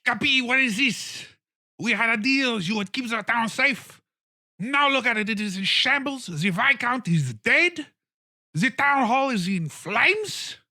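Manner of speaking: high, loud frantic scolding; stomping mayor berating Cappy; furious mayor. Acted. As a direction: rant at him like a ruined politician.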